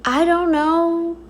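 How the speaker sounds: ordinary delivery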